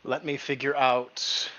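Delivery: ordinary delivery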